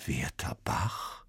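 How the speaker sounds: slow, unvoiced whisper; whispered wonder. A narrator's reading. Sven whispers the name in disbelief. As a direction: breathe it in disbelief.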